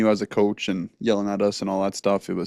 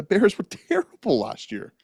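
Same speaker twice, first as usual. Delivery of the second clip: chuckling through the words; amused laugh on terrible; 9 semitones higher than usual. Real, not acted. Laugh heard mid-line.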